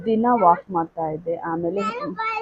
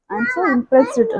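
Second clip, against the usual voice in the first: impressed; 14 semitones higher, much slower than usual. Real, not acted. Praising viewers' weight loss.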